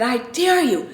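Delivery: high, taunting dare at another driver; mock-furious, daring him to step out; retold road rage challenge. At a honking driver behind her, a high taunting dare. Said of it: "and I said".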